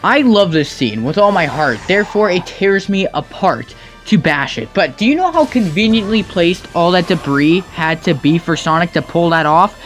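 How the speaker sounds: usual speaking voice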